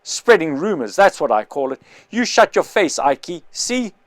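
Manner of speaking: bully them into silence; angry shouting. Acted. The big man threatening the whisperers, loud and angry. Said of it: "shouted the big man".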